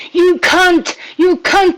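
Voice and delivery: high, loud mock yelling; imitated yell. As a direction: shout it like a heckler. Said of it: "Curve comes in and says".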